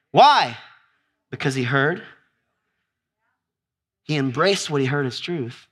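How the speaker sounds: ordinary talk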